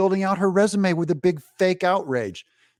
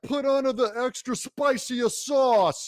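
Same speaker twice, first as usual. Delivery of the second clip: ordinary talk, nothing marked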